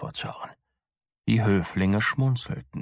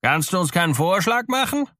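high-pitched impatient demand; impatient king demanding; 10 semitones higher, 3 dB louder than usual. A narrator's reading. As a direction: press for an answer, raised voice. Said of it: "sagte der König ungeduldig".

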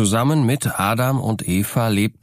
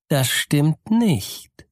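slow, higher, sly snake hiss; serpent dragon; 6 semitones higher, much slower than usual. Acted. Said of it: "zischte die Schlange arglistig". (arglistig zischende Schlange)

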